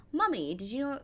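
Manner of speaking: child voice in a put-on British accent. Mother imitating her four-year-old son, British accent. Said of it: "a British accent with that".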